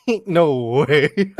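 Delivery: drawn out, breaking into laughter; laughing disbelief. Real, not acted. Streamer laughing at own chav attempt, slow and giggly. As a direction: say it slowly, cracking up. Repeats it cracking up.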